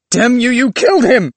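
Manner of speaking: high, loud furious shout; furious accusation. Acted. Willis confronts Clive over his friend's death, furious shout. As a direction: hurl the accusation at full voice.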